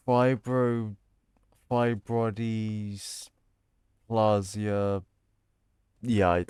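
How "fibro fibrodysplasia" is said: slow sounding out. Real, not acted. Sounding out a hard word.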